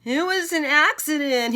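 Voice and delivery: high loud dragon voice; roaring dragon. Acted. Dragon roaring its defense at the king. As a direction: protest loudly as the big beast. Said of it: "he roared".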